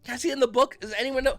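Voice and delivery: mock-asking the room; mock disbelief. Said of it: "I'm sitting there going".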